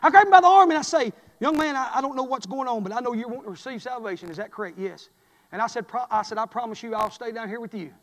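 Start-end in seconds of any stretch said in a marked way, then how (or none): 1.4-7.9 put-on soft, gentle promise to a boy; quiet, reassuring pastor voice; softly comforting a frightened boy; tender reassurance. Preacher gently speaks to boy.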